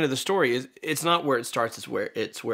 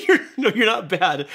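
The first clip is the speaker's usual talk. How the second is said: laughing; 7 semitones higher, 7 dB louder than usual. Real, not acted. Laughing reassurance.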